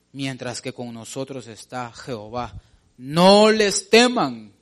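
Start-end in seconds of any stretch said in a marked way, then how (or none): 3.0-4.5 slow, loud proclamation of "No les teman"; booming "No les teman", every word drawn out; exclaimed command. Shown as a demonstration. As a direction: read the verse out as a shout. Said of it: "Está con signos de admiración".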